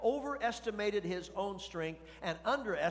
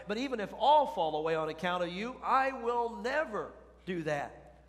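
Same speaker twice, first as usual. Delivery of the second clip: emphatic vow; 4 semitones higher, 4 dB louder than usual. Acted. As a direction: swear it loudly, stretching out each key word. Peter's emphatic vow, read aloud.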